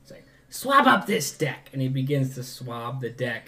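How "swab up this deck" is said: higher, slower goblin bark; slow high-pitched goblin yell; goblin shout. Acted. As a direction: bark the order like a nervous goblin. Goblin NPC ordering swabbing.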